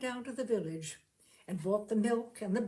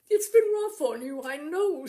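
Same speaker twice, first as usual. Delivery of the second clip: tearful; 5 semitones higher, 9 dB louder than usual. Acted. Fred weeping, laugh-cry heard.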